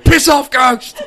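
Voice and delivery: high, loud mock yell at the ghost; Korg's yell. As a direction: shout it like Korg kicking the ghost. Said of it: "He's like".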